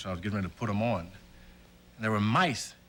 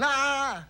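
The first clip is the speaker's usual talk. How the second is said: high mock scream of a scared boy; retold child scream; 12 semitones higher, 3 dB louder than usual. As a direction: squeal like a frightened little boy. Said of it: "And he's screaming".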